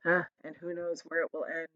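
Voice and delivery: soft gloomy mutter. A narrator's reading. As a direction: grumble under your breath. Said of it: "muttered the earthworm".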